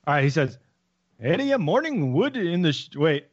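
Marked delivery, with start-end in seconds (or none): 1.2-2.9 slow, higher, singsong Irish attempt; attempted Irish accent. Shown as a demonstration. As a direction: stumble through a bad Irish accent. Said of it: "trying to focus on the accent".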